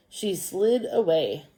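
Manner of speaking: slow, deliberate story reading; children's story read-aloud. Acted. Teacher reading a crow story to pupils, slow storytelling. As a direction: read slowly and clearly for young pupils.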